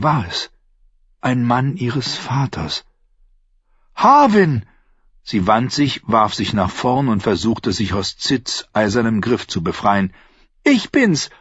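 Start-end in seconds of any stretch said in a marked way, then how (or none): 3.9-4.6 very high, loud call. A narrator's reading. Arya calls out. 10.6-11.3 much higher excited call. A narrator's reading. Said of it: "rief sie".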